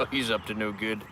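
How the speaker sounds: ordinary talk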